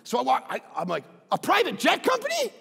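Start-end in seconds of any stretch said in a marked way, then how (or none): 1.3-2.5 high-pitched, incredulous double take; can't believe his luck; amazed disbelief. Retold or imitated. Pastor telling his congregation, amazed at golf partners.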